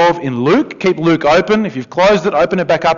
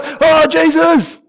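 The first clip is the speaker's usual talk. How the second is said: whiny complaint; 10 semitones higher, 3 dB louder than usual. Acted. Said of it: "and this guy goes".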